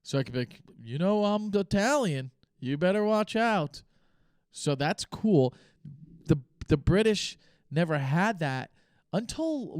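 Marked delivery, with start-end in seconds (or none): 0.8-3.8 mock mafia threat. Joking threat about being Italian.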